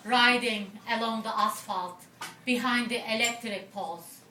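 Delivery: poetry recital in short, separated phrases; poem reading. Acted. Poet telling an audience of the desert trip, phrased poem reading. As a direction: recite it as narrative verse, pausing at line breaks.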